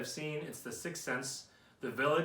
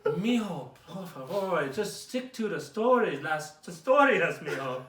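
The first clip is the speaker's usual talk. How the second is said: put-on higher, slower Mexican mom accent, a touch of Spanish; mom's accent; 4 semitones higher, 6 dB louder than usual. Friend mocking comic's mom giving notes, Mexican accent. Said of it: "Just everything in that accent".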